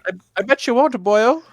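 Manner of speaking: high, hammed-up Irish brogue; bad Irish accent. Leprechaun daring Kirk, terrible Irish accent. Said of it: "The worst Irish accent I've ever heard".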